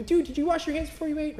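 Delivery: mother scolding a child over unwashed hands, hammed up; nagging parent. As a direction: play a parent catching a kid with dirty hands. Nagging parent scolding about handwashing.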